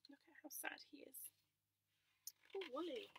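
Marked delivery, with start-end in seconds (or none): none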